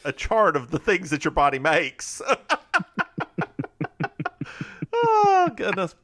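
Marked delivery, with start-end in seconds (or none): 2.3-5.0 long high-pitched laugh; real, drawn-out cackle; real laughing fit. Real, not acted. Bursts out laughing at own joke. 5.1-6.0 high, loud laughing exclamation; real laughter spilling into words; through laughter. Real, not acted. Recovering from laughing fit.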